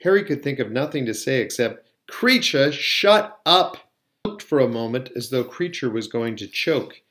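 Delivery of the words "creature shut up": loud, high furious command; exasperated shout. A narrator's reading. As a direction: shout it to silence the elf. Harry orders the shrieking elf silent.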